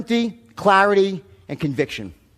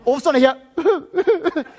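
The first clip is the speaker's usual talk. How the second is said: normal talking voice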